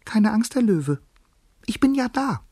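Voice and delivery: high, tender mouse squeak; delicate little voice. A narrator's reading. Mouse comforting the trapped lion, delicate and squeaky. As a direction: reassure in a tiny, high voice. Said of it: "sagte eine zarte Stimme".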